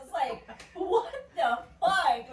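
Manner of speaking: usual speaking voice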